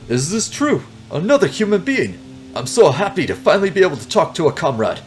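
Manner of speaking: high, badly faked Russian accent; hammed-up Russian accent, delighted to meet a comrade. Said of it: "that is a very bad Russian accent".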